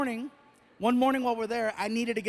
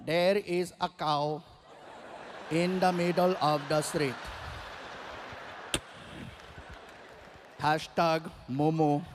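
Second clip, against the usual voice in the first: Indian driver tweeting; 5 semitones lower, 3 dB quieter than usual. Acted. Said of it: "The driver just shut off the car, started tweeting".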